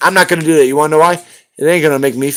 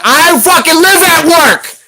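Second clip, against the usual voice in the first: much higher and slow, strained yell; exasperated yell; 12 semitones higher, 4 dB louder, slower than usual. Real, not acted. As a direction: let the frustration burst out. Venting at a moderator on stream.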